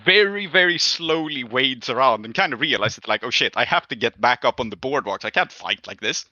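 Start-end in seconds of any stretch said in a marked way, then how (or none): none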